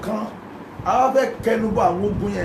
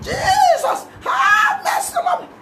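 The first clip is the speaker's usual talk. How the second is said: top of his voice. Retold or imitated. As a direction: scream it out like the blind man. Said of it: "shouted at the top of his voice".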